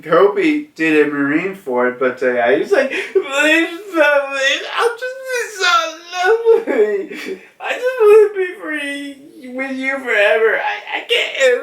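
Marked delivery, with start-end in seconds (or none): 2.9-11.6 very high, hammed-up sobbing plea; imitated blubbering, voice shooting way up; breaking down in tears. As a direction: beg through squealing mock sobs. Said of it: "starts breaking down in tears".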